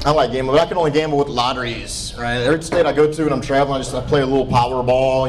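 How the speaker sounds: ordinary talk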